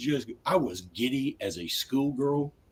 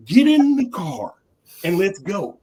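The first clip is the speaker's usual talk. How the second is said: much higher, louder, slow insistence; exasperated order; 8 semitones higher, 6 dB louder, slower than usual. Retold or imitated. Husband ordering wife into the car, loud exasperated. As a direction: drag it out, fed up. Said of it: "And I'm like".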